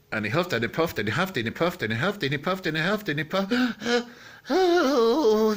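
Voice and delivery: fast, breathless run climbing in pitch to a gasp; building frantic huffing. A narrator's reading. Wolf's huffing builds to exhaustion.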